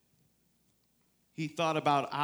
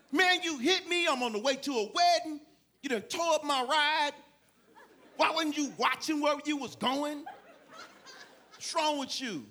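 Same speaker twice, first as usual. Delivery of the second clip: his usual angry self; 8 semitones higher than usual. Retold or imitated. Preacher showing congregation his usual self, mock angry. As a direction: play the selfish irate version of yourself. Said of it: "This is not how I talk".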